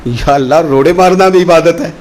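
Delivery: ordinary talk, nothing marked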